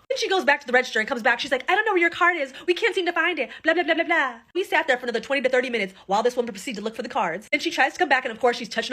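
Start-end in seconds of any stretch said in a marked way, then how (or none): 1.7-4.3 higher, dismissive waitress brushing them off; lightly voiced waitress; mock waitress excuse. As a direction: imitate her careless excuse. Waitress excuse about cards.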